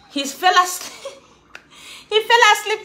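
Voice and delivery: laughing while telling it. Real, not acted. Telling viewers her son fell asleep, laughing.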